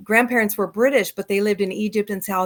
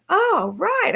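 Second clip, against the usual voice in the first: surprised realization; 10 semitones higher than usual. Retold or imitated. Realising ticket is good.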